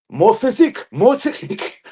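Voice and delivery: high, punched-out slogan; mock boast. Player taunting haters with slogans, mock boast. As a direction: chant it as a put-on brag.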